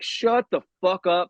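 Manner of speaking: acted irritation; hateful exasperation. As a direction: draw it out like a fed-up heckler. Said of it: "hates Jesus".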